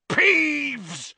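high, loud bellow of a name; enraged roar. A narrator's reading. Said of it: "Filch roared".

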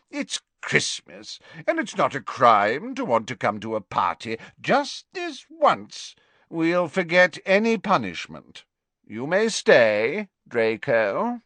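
put-on high, hearty old professor voice; a fond, tipsy potions master; jovial Slughorn. Tipsy teacher praising Harry to Trelawney, high and hearty.

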